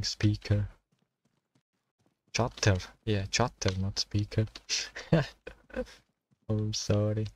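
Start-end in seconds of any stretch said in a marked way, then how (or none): 4.5-5.5 short, high, breath-heavy laugh; amused chuckle. Real, not acted. Gamer who mixed up chatter and speaker, amused chuckle. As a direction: chuckle briefly at your own slip. Laughs at his own word mix-up.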